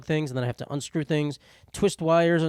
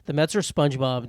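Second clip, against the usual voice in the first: normal talking voice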